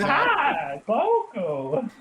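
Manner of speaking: put-on high old hermit voice; Master Roshi impression. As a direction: squeak out a lecherous old master. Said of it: "be your Master Yoshi".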